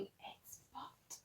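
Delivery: hushed, secret word; whispered punchline. A narrator's reading. Narrator whispering the cheeky punchline to the listener. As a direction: drop it to a whisper.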